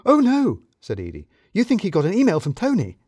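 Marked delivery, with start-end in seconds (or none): 0.1-0.6 high, loud dismayed gasp of words; sudden alarm, voice jumping up. Acted. Edie realises the danger. 1.5-3.0 high, louder worried question; alarmed Edie. Acted. Edie voiced by narrator.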